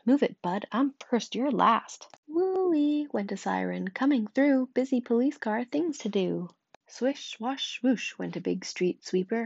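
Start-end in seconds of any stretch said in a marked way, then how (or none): 0.1-2.1 loud, bossy grocery truck; a grocery truck yells at Blue, loud and bossy; pushy truck shout. Acted. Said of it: "gave his horn a blast". 2.3-3.1 high, loud siren woo-wee; loud high siren cry; siren wail. Acted. Police siren sound. 3.9-6.5 high and loud, clearing the road; police car shouts at traffic, high and loud; calling out loud. Acted.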